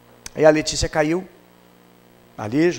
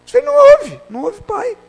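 mock scolding; 7 dB louder than usual. Parent scolding a tantruming child.

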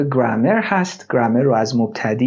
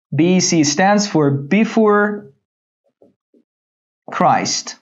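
slow teaching read; 3 semitones higher, much slower than usual. Shown as a demonstration. Teacher reads slowly.

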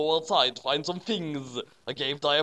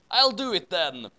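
ordinary delivery

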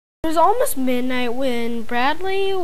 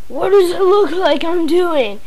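loud, higher sneering retort; scowling; 6 semitones higher, 5 dB louder than usual. A narrator's reading. Bratty son sneering at his mother, scowling. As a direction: snap back rudely. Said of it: "scowled Bradley".

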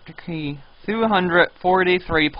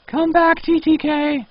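high, loud call out; high-pitched plea called out; mock call; 14 semitones higher, 4 dB louder than usual. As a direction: shout for him to return. Calling player back.

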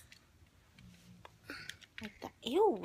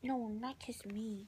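softer whispered refusal; 7 dB quieter than usual. Real, not acted. Parent whispering to the baby trying to kiss. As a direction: whisper it gently.

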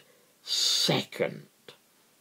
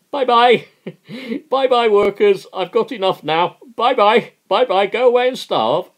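much higher, louder sing-song bye-bye; mocking capitalist; 13 semitones higher, 11 dB louder than usual. Capitalist dismissing the starving workers, hammed-up smug farewell. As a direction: wave the workers off with smug glee.

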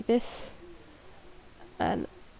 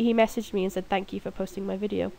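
ordinary talk

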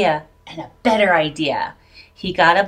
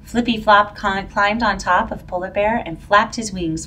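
usual speaking voice

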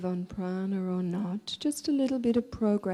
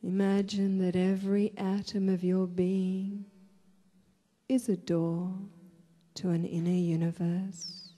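guided meditation; 4 dB quieter than usual. Real, not acted. Leads a breathing meditation.

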